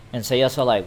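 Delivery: ordinary delivery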